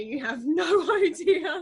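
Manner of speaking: high, squealing laugh on the last word; breaking into laughter. Real, not acted. Telling colleagues how much she cried, laughing. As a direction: let it rise and dissolve into helpless laughter. Laughing over own crying story.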